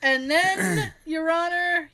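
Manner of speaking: normal talking voice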